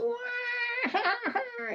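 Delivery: put-on high, drawn-out baby wail; imitated baby crying. Storyteller imitates the baby the boy hears, wailing. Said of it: "he hears a baby crying".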